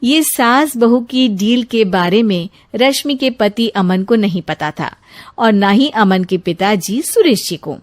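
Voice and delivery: ordinary delivery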